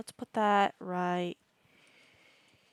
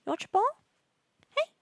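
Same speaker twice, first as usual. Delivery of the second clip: high pet voice; 13 semitones higher than usual. Real, not acted. Player greeting pet Rika, high pet voice. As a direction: sing out her name like greeting a puppy. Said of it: "With the stupid high voice".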